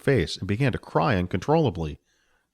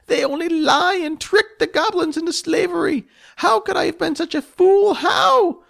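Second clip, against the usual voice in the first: high, loud goblin lament building to a cry; a betrayed goblin cursing her own trust; wailing goblin; 5 dB louder than usual. Acted. Spleef mourns trusting a human, rising to a wail.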